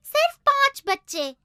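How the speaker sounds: normal talking voice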